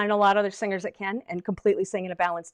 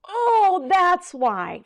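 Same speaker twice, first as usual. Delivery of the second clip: realization; 9 semitones higher, much slower than usual. Retold or imitated. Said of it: "all melted together into this".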